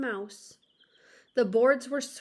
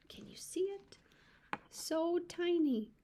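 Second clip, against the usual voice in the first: whispered aside; 7 semitones higher, 5 dB quieter than usual. Real, not acted. Aside to child listener.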